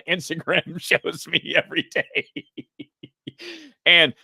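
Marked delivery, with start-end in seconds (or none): 0.0-2.9 laughing. Real, not acted. Laughs while dodging what he likes.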